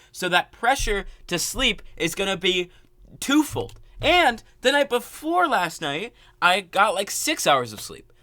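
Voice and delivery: high, loud and drawn out; agitated venting. Real, not acted. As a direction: stretch it out in frustration. Venting about lost sleep.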